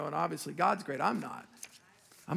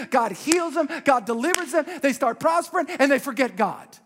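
loud, high and driving, hammer it home; preaching at full force; 7 semitones higher, 5 dB louder than usual. Acted. Pastor pleading with his congregation, loud and high.

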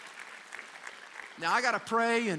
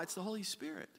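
usual speaking voice

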